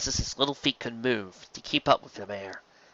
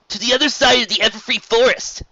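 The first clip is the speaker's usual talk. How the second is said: put-on high, louder boyish voice; young dragon voice; 11 semitones higher, 6 dB louder than usual. A narrator's reading. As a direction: blurt it out boyishly. Spike explains.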